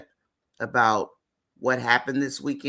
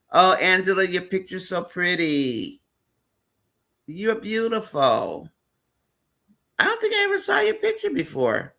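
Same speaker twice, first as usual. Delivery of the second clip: rising, delighted gush over a pretty photo; light up with delight as you compliment her; 5 semitones higher than usual. Real, not acted. Host gushing to viewer Angela about her photo, delighted.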